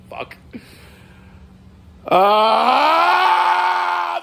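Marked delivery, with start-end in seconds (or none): 2.1-4.2 loud, high exasperated yell; a long angry cry at the post office; frustrated shout. Real, not acted.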